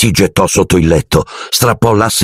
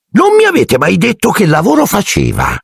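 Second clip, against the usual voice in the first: aunt Marge voice; 13 semitones higher than usual. A narrator's reading. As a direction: look down your nose at the boy's family. Aunt voiced in read-aloud story.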